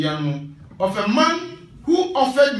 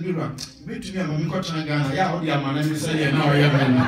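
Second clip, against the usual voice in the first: rushed, lower mock plea; lightly voiced wife, rushed and half laughing; quick pleading wife; 6 semitones lower, much faster than usual. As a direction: rattle off a wife's demand half laughing. Wife's quoted plea, fast.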